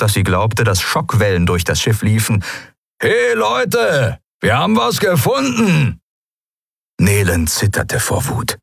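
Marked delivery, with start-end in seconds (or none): 3.0-6.0 high-pitched roar to the whole crew; bellowing. A narrator's reading. Skria announcing a find to the crew, roaring. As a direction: bellow it out across the ship. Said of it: "Dann brüllte er so laut".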